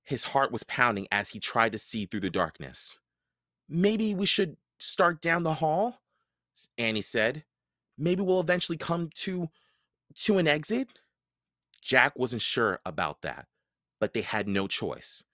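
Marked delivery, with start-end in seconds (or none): 3.7-11.0 put-on hopeful girl. A narrator's reading. Annie suggests.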